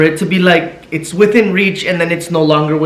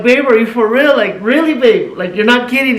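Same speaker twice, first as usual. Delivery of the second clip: higher, eager questioning; excited disbelief; 6 semitones higher than usual. Retold or imitated. Husband asking his wife if she is really pregnant, excited disbelief. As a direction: ask it like you can't believe it.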